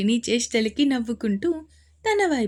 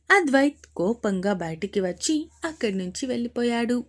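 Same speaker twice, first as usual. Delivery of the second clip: ordinary delivery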